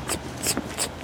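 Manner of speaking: whispered zip zip beat; vocal zip sound. Shown as a demonstration. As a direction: make the zipping rhythm with your mouth. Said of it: "für unsere Musik".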